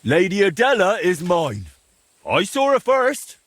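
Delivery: high, slow, hammed-up boast; hammy rival. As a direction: overact the jealous suitor. Staged rival claim in duel.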